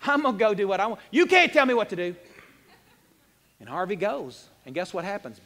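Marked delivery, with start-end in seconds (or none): 0.0-2.2 louder, rising defiant shout; defiant outburst. Retold or imitated. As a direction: snap back like a rebellious teen. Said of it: "Harvey's like".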